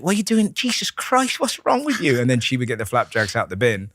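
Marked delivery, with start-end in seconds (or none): 0.0-2.2 imitating mum. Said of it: "she's like".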